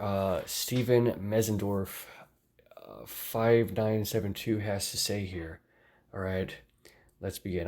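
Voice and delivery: ordinary delivery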